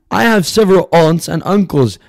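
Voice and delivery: ordinary talk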